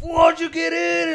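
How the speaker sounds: high slow shouted question; surprised shout. Retold or imitated. Friends shocked he got back in, slow high shout. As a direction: yell it across the dance floor.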